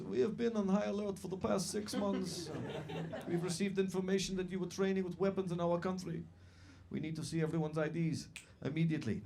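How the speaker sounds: put-on stern cop in a broad Eastern European accent. Cop addresses the stag party, Eastern European accent. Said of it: "this is my Eastern European accent".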